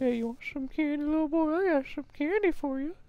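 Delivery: high sugary creepy stranger voice; sweet creepy coaxing. Pretend. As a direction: lure a kid with candy, sing-song.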